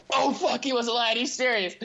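high, alarmed gasp of disbelief; panicked surprise. Retold or imitated. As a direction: blurt it out like you've just realised he meant it. Friends panicking at cops' knock.

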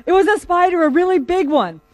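high, loud acted self-defence; insistent protest. Man defends himself to incredulous police.

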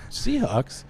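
high, incredulous question; surprised double take. Retold or imitated. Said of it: "And I'm all".

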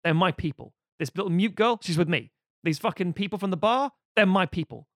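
the knight's mock defiant stand against Death; defiant claim. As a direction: plant your feet and claim your people. Knight defends his companions.

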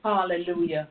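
usual speaking voice

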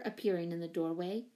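plain reading voice

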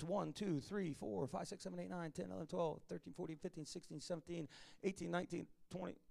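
soft, murmured counting of stars; counting under breath. Shown as a demonstration. Preacher to church, counting stars softly under his breath. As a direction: count the stars quietly to yourself.

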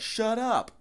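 very high, hammed-up rebuke; mock irritated yell. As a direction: pretend to scold a dying man. Mocking the crew telling him off.

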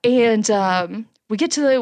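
ordinary talk

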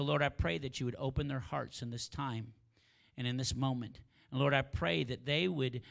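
rising, fervent pastor's prayer; preaching prayer. Acted. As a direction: pray it out over the congregation with building force. Leads the closing prayer.

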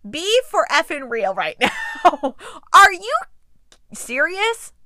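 high, loud disbelief; incredulous. Real, not acted. Incredulous rant at character.

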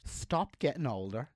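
slow, petulant grumble; whiny mock complaint. Parent sulking at son growing older, slow whiny complaint. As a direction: sulk at the kid like a child yourself. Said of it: "I'm like".

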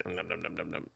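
low, quiet nom-nom eating noises; mock munching. Co-host playing an eater of the dipped bread, munching. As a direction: trail off into muffled pretend chewing.

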